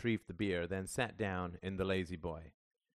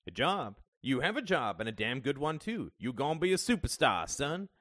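raised, emphatic pep talk; indignant encouragement; 7 semitones higher, 5 dB louder than usual. A narrator's reading. Father insisting his son will be a superstar, indignant and fired up. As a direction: protest loudly, hyping your kid up. Dad indignant at son's suggestion.

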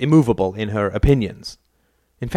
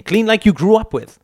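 put-on mother insisting on the old ways, raised; insistent, louder; 11 semitones higher, 6 dB louder than usual. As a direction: stress on you, higher and louder.

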